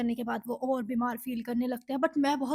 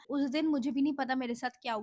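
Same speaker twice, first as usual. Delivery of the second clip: ordinary talk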